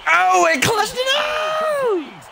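high, drawn-out holler; loud slow shout stretching the last word; real outburst at a clutch play; excited yell. Real, not acted. Yells at a big play.

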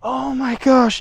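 ordinary talk, nothing marked

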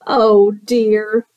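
plain reading voice

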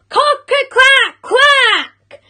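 high, loud rooster crow ending in quacks; shouted crow. A narrator's reading. Cockerel trying again to crow, shouted high. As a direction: belt out a crow that turns into quacking. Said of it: "So he tried again".

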